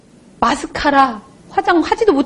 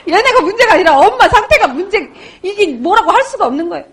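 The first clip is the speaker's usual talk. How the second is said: higher, laughing through the words; 4 semitones higher than usual. Real, not acted. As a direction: tell it while cracking up at yourself. Laughs at her own sorry state. (웃음 섞인 목소리)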